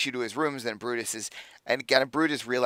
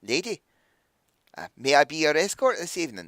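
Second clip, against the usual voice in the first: courtly dwarf; 4 semitones higher than usual. Acted. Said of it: "little dwarven elbow".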